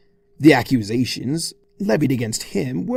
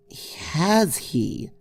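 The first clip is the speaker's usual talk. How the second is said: a little higher, scheming; sly Twilight; 5 semitones higher than usual. Acted. Twilight plotting revenge on Discord, sly. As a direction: savour the chance.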